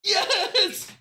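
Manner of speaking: high, elated victory yell; jubilant cry. Real, not acted. Announcer after Barroso's win, jubilant yell. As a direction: let out a triumphant shout.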